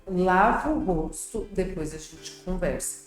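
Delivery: slow, firm parental commands; firm and deliberate, each command set apart. Shown as a demonstration. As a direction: give clear orders, slowly and evenly. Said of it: "Eu tô sendo firme, falando devagar".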